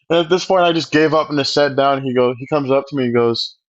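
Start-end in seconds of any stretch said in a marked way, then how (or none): none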